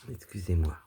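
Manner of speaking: coughing, choked up; half-choked apology after a cough; choking on saliva. Real, not acted. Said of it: "Je m'étouffe avec ma salive".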